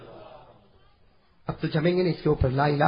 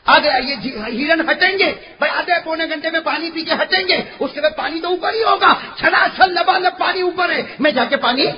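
loud, high-pitched sermon storytelling; impassioned preaching; 11 semitones higher, 8 dB louder than usual. Acted. Preacher telling the well story to the crowd, impassioned. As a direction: preach it at full force, pitch raised.

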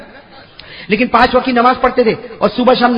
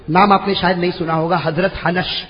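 ordinary talk, nothing marked

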